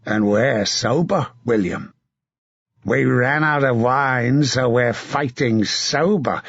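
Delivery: a battle-hardened knight urging a comrade on; hard, rough commander's voice; grim veteran knight giving orders; gruff knight. Acted. Sir John jokes before the clash.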